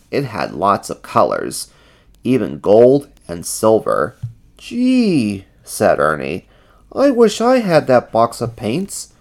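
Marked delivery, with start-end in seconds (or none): 4.6-5.4 high, wondering character voice; high-pitched little exclamation; Ernie's voice. A narrator's reading. Ernie admires the paints. 6.9-9.1 high, wishful character voice; high-pitched longing; Ernie's voice. A narrator's reading. Ernie wishes for the paints.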